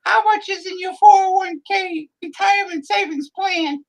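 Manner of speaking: high falsetto woman's voice; squeaky high lady voice; female voice. Acted. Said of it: "me do the female voice".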